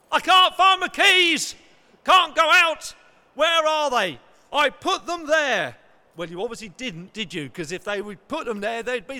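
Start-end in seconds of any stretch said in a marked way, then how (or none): 0.1-5.8 loud, higher panicked cry over lost keys; panicked shout. Retold or imitated. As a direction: shout it in a panic, searching everywhere.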